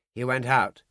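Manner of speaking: ordinary delivery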